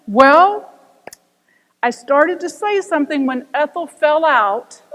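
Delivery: deadpan turning into a laugh; punchline delivery. Retold or imitated. Fred answering the pilot, laughing.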